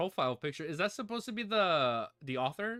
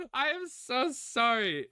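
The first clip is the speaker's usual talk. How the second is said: laughing, slow and high; laughing apology; 12 semitones higher, 4 dB louder, slower than usual. Real, not acted. Owner laughing apologizing while puppy licks mic.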